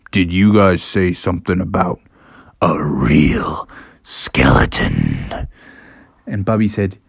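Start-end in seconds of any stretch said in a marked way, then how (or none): 0.1-5.5 very low, slow, hushed voice; ominous Father Bear. Acted. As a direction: ask it slowly, deep and husky. Father Bear comes downstairs.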